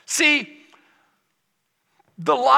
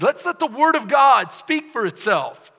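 normal talking voice

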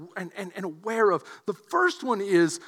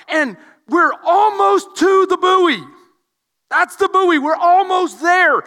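loud, building preacher's telling; preaching; 6 semitones higher, 7 dB louder than usual. Acted. Pastor building the swim story for church, loud. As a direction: push the story to its peak.

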